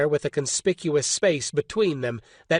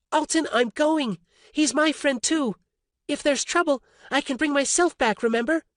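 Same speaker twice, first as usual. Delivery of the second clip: higher woman's voice, steady; Orly, determined; 10 semitones higher than usual. A narrator's reading. Orly arguing with Alton, determined woman's voice. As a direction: calmly refuse to stay behind.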